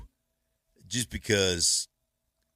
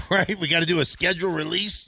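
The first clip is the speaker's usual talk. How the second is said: higher, louder, fast mock panic; mock panicked team; 10 semitones higher, 6 dB louder than usual. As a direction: play a staffer caught off guard. Broncos caught off guard.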